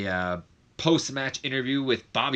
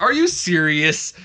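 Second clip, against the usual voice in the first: slow, higher, louder outraged question; Cole's indignant disbelief; 7 semitones higher, 9 dB louder, slower than usual. Retold or imitated. Commentator annoyed at Bobby Roode interrupting, slow indignant outcry. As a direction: drag it out in mock outrage. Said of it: "he's like".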